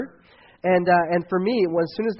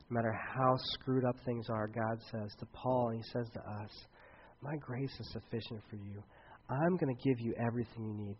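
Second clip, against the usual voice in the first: tender sermon promise; 6 semitones lower, 14 dB quieter than usual. Acted. Pastor gently assuring a worried congregation, soft and tender. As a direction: lay each promise down gently, almost hushed.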